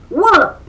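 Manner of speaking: high loud cartoon wallop; wallop sound. A narrator's reading. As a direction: smack out the impact. Fight sound effect.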